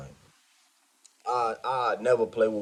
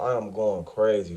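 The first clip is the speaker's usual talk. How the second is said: ordinary talk, nothing marked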